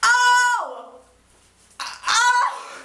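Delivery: very high, loud delighted squeals; excited squeal. Retold or imitated. Fan squealing as her idols poke her cheeks. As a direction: squeal with overwhelmed joy. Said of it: "And I'm like".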